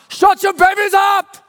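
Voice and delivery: big guy's high, loud bellow, imitated; Ivan Drago-like yelling. Big angry passenger to the moms on a midnight flight, yelling. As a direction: yell it as a hammed-up Rocky villain. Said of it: "A big guy starts yelling at the moms".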